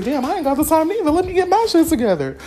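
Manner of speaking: high, loud, fast self-quote; mock alarmed realization. Telling listeners her own panicked thought, squealed high. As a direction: squeal it as a sudden wake-up call. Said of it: "I was like".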